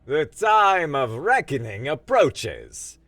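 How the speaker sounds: loud, slow doom voice; ominous announcement. Acted. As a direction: boom it like a prophecy of doom.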